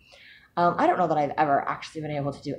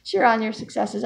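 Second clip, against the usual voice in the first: aunt's voice; 12 semitones higher than usual. Retold or imitated. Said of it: "my aunt is like".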